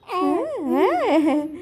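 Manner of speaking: slow, laughing yes-yes. Real, not acted. Mother laughing with baby.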